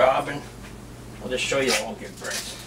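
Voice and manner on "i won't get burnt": usual speaking voice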